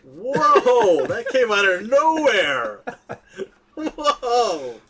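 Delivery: loud, high, drawn-out whoa of disbelief; real astonishment. Real, not acted. Host floored by friend's out-of-nowhere character pick, astonished. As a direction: blown away, voice shooting up.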